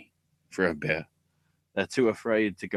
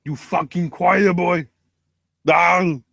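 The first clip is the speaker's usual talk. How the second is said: higher, louder, slow snarling movie villain line; movie quote; 8 semitones higher, 7 dB louder, slower than usual. Retold or imitated. Quoting a favourite film line to the co-host, hammed-up snarl. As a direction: bark the quote like the film character. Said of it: "the best quote from all of movies".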